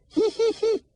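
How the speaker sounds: very high, loud whinny; high loud horse whinny. Shown as a demonstration. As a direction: neigh like a horse.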